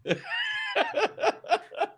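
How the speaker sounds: high squealing laugh. Real, not acted. Laughing hard.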